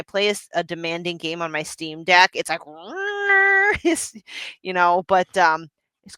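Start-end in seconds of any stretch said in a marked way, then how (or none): 2.7-3.8 high, rising mechanical whir; imitated fan whine. Gamer showing co-hosts how loud the Steam Deck gets, imitated whir. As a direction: imitate a console revving like a plane.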